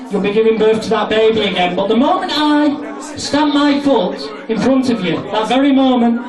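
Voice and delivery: ordinary talk, nothing marked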